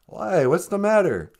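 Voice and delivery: usual speaking voice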